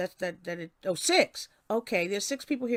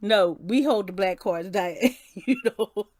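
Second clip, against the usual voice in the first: laughing; 6 dB louder, slower than usual. Real, not acted. Laughing banter with viewer Diane.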